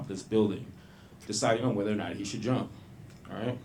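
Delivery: low, soft, solemn address; subdued public service announcement; earnest PSA. Acted. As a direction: gently counsel the audience as if sincere. Mock-serious public service announcement.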